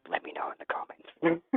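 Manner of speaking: whispered aside, very soft; whispering. Real, not acted. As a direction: breathe it out as a whisper. Quiet aside after rambling.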